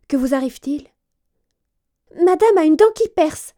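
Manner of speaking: acting out the bedside exchange; voiced story figures. A narrator's reading. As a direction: courtiers at a sick princess's bedside. Governess and maid voiced.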